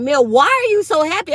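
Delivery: lightly voiced coworker; a hint of a curious supervisor. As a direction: ask it like a puzzled boss. Supervisor quoted at work.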